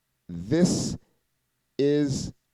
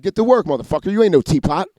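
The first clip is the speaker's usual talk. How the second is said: lightly voiced barking uncle, a bit louder; Uncle Jimmy; 6 semitones higher, 6 dB louder than usual. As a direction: order him back to work.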